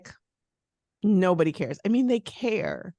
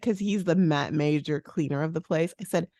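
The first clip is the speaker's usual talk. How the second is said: ordinary delivery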